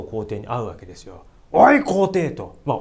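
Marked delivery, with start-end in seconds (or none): 1.5-2.3 loud gruff call to the emperor; angry rebuke. Retold or imitated. Ito confronting the emperor, loud and angry. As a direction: bark at the emperor. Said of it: "ものすごい剣幕で".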